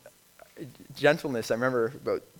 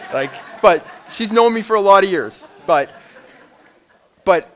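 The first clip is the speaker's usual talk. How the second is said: laughing; 8 semitones higher, 14 dB louder than usual. Real, not acted. Laughing about wife and swearing.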